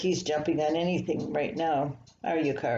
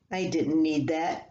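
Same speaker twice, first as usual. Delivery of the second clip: ordinary delivery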